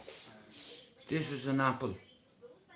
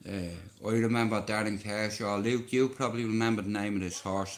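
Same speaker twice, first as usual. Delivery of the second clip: ordinary talk, nothing marked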